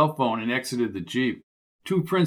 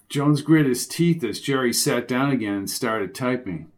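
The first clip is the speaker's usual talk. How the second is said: ordinary delivery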